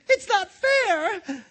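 very high, loud, drawn-out tantrum wail; crying out petulantly. A narrator's reading. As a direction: shriek it like a spoilt boy. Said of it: "cried the Grand Duke".

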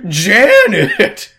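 calling a name through laughter; laughing call. Retold or imitated. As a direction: shout the name while laughing. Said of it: "Lauren just goes".

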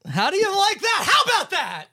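put-on Australian, rising high at the end; Australian accent. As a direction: show off a hammed-up Australian accent. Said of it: "that was my Australian accent".

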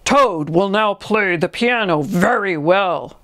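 slow, stately stage announcer; drawn-out show introduction; strange faraway voice. A narrator's reading. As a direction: announce the act in long, ringing syllables. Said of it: "said the strange voice".

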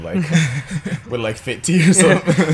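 laughing through the words. Real, not acted. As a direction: giggle out the line. Laughing at the enema joke.

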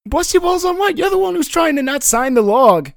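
high, loud comic indignation; indignant quarrelling. Acted. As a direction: throw the accusation straight back, voice pushed up. Acted quarrel over the log.

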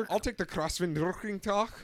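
loud, high, harsh mock-German order; hammed-up German yelling; mock angry German. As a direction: bark out a mangled German word. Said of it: "you have to be angry to speak German".

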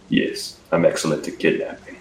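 lightly voiced action-hero threat; Liam Neeson voice. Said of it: "Liam Neeson".

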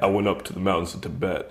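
a low, hushed, self-serious fighter; Razorblade. Acted. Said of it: "He's like".

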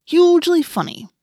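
loud, high swooping scorn; sarcastic. A narrator's reading. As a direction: act thoroughly unamused by a joke. Marco answers being teased.

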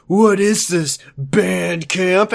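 hammed-up sneering question; mock a jerk's sarcastic jab; shithead sarcasm. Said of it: "Ricky is being a real shithead right here".

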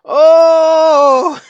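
high, drawn-out "oh" at a burn; long loud groan. Real, not acted. Hearing the dialect coach's put-down, long high howl. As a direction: howl "oh" in reaction to a zinger.

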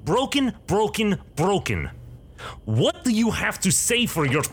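high scolding tirade in a bad Russian accent; angry old woman. Gray-haired woman scolding Amar for the wreckage, high angry Russian accent. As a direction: berate him like an outraged curator. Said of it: "she turns to you and says".

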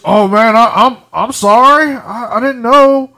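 high, loud, mock-startled and slow; startled apology. Booker T apologizing after being yelled at, high mock-startled apology. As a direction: play him as a spooked cartoon dog caught off guard. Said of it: "like he's Scooby-Doo with a van coming at him".

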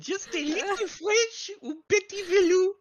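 very high hammed-up French voice; silly French accent. Coaxing a friend to be French, high silly French accent. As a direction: coax in squeaky mock French. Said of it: "why am I French now?".

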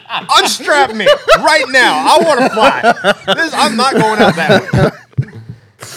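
high, loud, slow, laughing the demand; hammed-up terror while cracking up; laughing mock panic. Panicked joke after near bird strike.

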